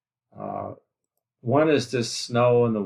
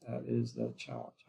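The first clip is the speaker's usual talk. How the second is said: soft, much lower restatement of "That is the challenge"; quiet low echo; 10 semitones lower, 12 dB quieter than usual. Acted. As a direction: lower and softer, "That is the challenge" dropped to a low, falling murmur. Repeats point as quiet echo.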